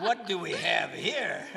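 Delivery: loud, high, drawn-out mock amazement; laughing. Doctor's shock retold by a parent, laughing. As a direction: draw it out through laughter. Joking about staff's reaction.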